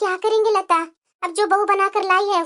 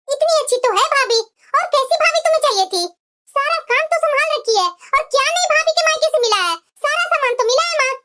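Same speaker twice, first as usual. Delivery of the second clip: higher, pleading with mother; protesting daughter; 5 semitones higher than usual. Acted. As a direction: defend the sister-in-law, voice up. Daughter argues back with mother.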